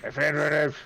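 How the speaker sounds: Hot Fuzz yokel. Retold or imitated. Quoting Hot Fuzz to the group in a mock yokel accent. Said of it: "a Hot Fuzz moment where it's like".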